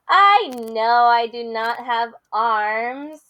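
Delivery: lively picture-book read-aloud with character voices; reading to children. Acted. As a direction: read it big for the kids.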